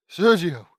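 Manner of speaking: loud, high cry of shock; startled shout at an unexpected sight. A narrator's reading. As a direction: call the name out in shock.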